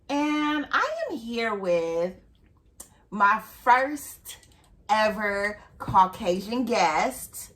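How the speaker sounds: upbeat host welcome, drawn out; podcast host intro. Acted. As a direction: open the show like a host.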